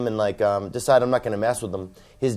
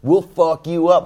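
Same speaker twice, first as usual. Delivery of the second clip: slower, higher and louder threat; mock furious threat; 6 semitones higher, 6 dB louder, slower than usual. Angry dad threatening the texter, hammed-up fury. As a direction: lean into a comic tough-guy threat. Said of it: "He gets furious".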